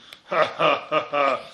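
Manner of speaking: loud acted laugh in a steady run of ha-ha; staccato ha-ha-ha laughter; landlord's laugh. A narrator's reading. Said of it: "Der Wirt lachte".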